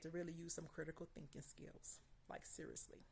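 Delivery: hushed confiding whisper. Real, not acted. To viewers about the test, whispered aside. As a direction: drop to a secret whisper for emphasis.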